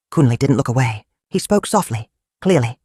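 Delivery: usual speaking voice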